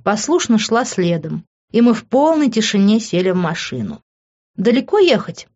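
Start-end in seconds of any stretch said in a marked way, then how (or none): 4.6-5.5 higher questioning tone; inquiry. A narrator's reading. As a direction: lift the pitch into a question. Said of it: "осведомилась я".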